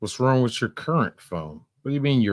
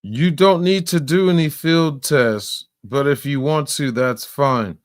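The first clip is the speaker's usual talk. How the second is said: mocking imitation; 7 semitones higher, 5 dB louder than usual. Mocking the woman's words back.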